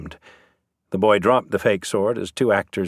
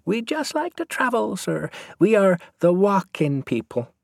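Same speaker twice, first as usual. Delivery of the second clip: put-on high-pitched boy with a thick Irish Traveller accent; 12 semitones higher than usual. A narrator's reading. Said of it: "His accent was thick".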